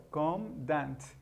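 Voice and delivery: usual speaking voice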